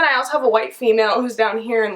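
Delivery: ordinary talk, nothing marked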